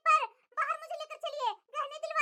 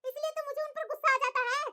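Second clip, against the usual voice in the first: normal talking voice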